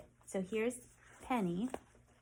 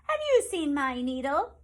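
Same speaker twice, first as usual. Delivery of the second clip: put-on higher, louder old woman's voice; a lightly voiced old woman searching; old woman asking; 6 semitones higher, 10 dB louder than usual. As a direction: ask it as a worried old woman. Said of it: "the woman asked".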